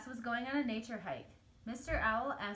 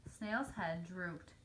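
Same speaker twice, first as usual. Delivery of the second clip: ordinary delivery, nothing marked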